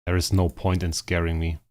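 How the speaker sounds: normal talking voice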